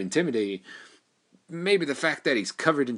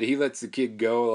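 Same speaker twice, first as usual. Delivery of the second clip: ordinary talk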